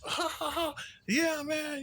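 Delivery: high, silly laughing voice; high goofy drunk laugh. Retold or imitated. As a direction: ham up a giddy drunk. Imitating someone drunk.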